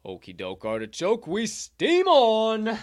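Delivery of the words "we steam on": drawn-out catchphrase. Acted. Host catchphrase moving on.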